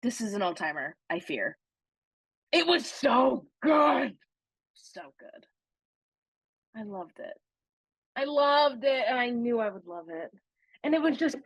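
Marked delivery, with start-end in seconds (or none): 2.5-4.2 slow, loud, drawn-out rave; excited shout. Real, not acted. Gushing about favourite house. 4.7-7.3 breathy whisper, much softer; whispered echo. Real, not acted. Hushed echo after shouting.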